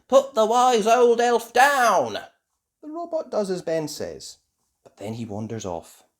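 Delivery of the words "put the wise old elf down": high, loud, drawn-out order; shouting. A narrator's reading. Ben rescuing the old elf, shouted order. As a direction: shout each word as a command. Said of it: "shouts Ben".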